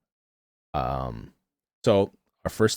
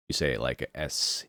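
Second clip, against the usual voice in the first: ordinary delivery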